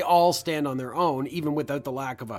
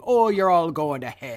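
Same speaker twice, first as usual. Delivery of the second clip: mock Irish mother; slower than usual. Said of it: "Mother just saying".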